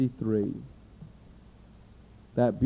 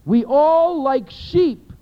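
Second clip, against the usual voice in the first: rising, louder preaching read of Isaiah; fervent pulpit reading of scripture; 2 semitones higher, 4 dB louder than usual. Acted. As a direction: proclaim the passage, building in force. Preacher reads Isaiah aloud.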